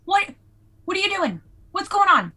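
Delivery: high, urgent questioning; agitated confrontation. Acted. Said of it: "turning them around and shoving them".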